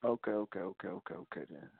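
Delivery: starting plain, then fading to a near-silent mutter; fading okays. Real, not acted. Conceding, trailing off.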